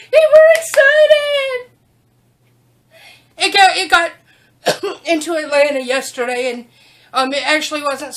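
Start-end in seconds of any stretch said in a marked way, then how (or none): 0.1-1.7 high, slow, loud joyful exclaim; gleeful exclaim. Pretend. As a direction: play up the dogs' excitement. Mock-voicing the dogs' excitement.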